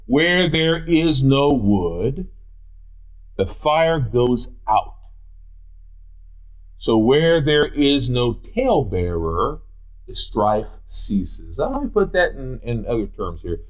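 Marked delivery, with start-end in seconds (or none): 0.1-10.7 scripture reading. Acted. Reads scripture slowly.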